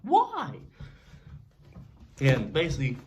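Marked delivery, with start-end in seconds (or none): 0.0-0.6 high, pained plea of why; anguished why. Retold or imitated. Robbed kid telling his mom, high pained why. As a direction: cry out why in hurt disbelief. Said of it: "I'm like".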